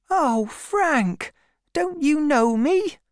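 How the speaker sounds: put-on high, pleading girl's voice; hurt young woman, high-voiced; Fanny Robin. A narrator's reading. Fanny pleading with her lover.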